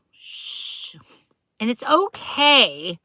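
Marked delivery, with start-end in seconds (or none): 0.1-1.0 long whispered shush; playful hush for a secret; secretive shushing. Pretend. Telling viewers to keep the secret, playfully shushing. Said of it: "But it's the secret".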